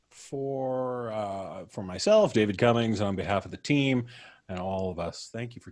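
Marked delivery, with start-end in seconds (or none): none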